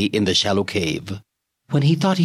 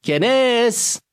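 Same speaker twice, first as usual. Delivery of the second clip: high, louder call into the dark. Acted. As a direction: call out louder, a little uneasy. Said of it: "and louder".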